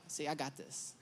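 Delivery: hushed, low aside to a partner; very soft and low, on the sly; confiding aside. Retold or imitated. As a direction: murmur it under your breath, sure of yourself. Confident aside to his wife.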